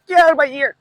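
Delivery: sudden high, loud, fast yelp; real startled outburst. Real, not acted. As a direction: burst out at a pest buzzing in your ear. Swatting away something at his ear.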